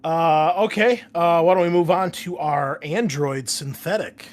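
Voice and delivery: game master raising voice; loud host call. Real, not acted. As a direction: bring the table back to order. Host calling next player.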